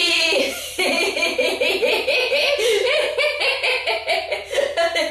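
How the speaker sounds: long high "é" laughter; high giggling "éééé"; throat laugh. Shown as a demonstration. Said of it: "Avec moi tous ensemble".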